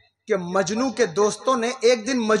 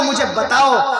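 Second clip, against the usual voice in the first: normal talking voice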